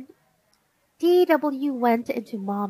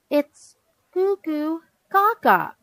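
put-on higher-pitched correction of a baby; lightly acted know-it-all girl, spelling it out; bossy explaining; 7 semitones higher, 4 dB louder than usual. A narrator's reading. Big sister correcting baby's babble, bossy explaining. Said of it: "D W explained".

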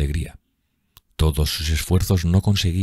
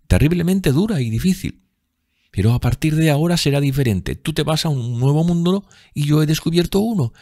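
put-on high girlish voice, full of joy; happy, teasing sister; 11 semitones higher than usual. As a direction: chatter brightly to a brother, pitched well up. Voices Sibyl teasing her brother. (hermana alegre y cariñosa, voz muy aguda)

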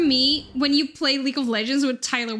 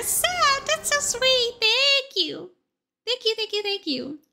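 touched squeal; 7 semitones higher than usual. Real, not acted. Streamer thanking chat for praise, high and gushing. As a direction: gush like the praise got to you.

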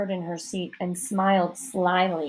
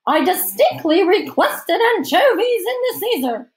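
high, loud, haughty complaint; hammed-up fury over a wrong order; indignant hostess; 11 semitones higher, 6 dB louder than usual. A narrator's reading. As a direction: protest in shrieking society-lady outrage. Hostess complaining about her order.

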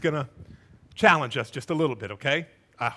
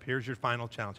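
ordinary talk, nothing marked

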